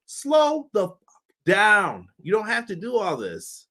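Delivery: ordinary talk, nothing marked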